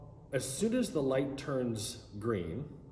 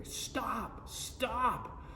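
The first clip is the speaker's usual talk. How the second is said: high yelled stop, pitch leaping up; screaming stop; 11 semitones higher, 4 dB quieter than usual. Retold or imitated. Banging on the car window, yelling at the driver to stop. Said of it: "I'm, like, screaming".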